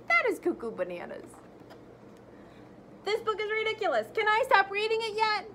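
lively, animated read-aloud; reading to children. Acted. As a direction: read it big for the kids. Teacher reads the book aloud expressively.

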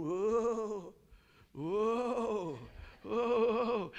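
loud drawn-out "whoa" like something rushing; imitated whooshing. Man mimics a speeding thing for the crowd, loud drawn-out whoosh.

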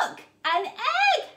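usual speaking voice

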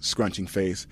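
ordinary talk, nothing marked

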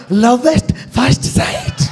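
high, drawn-out mock-romantic line; mock filmi romance. Quoting what they say in movies, mock-dreamy. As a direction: stretch it slowly like a film cliché. Mimics what people say in films.

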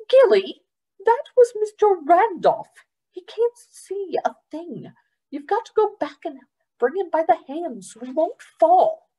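high, scolding foster mother; Trotter scolding. Acted. Trotter scolding Gilly for running off, exasperated. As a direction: tell her off, exasperated.

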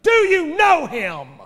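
loud, high, slow preacher's challenge; full-force preaching. Real, not acted. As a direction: hammer each question at the room.

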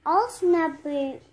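usual speaking voice

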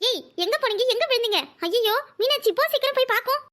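high-pitched, urgent fluster; anxious rush to get help; alarmed. Acted. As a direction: react in alarm and hurry everyone along. Alarm at news of injured child.

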